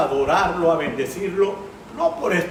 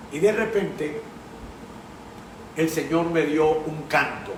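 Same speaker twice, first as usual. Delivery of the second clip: normal talking voice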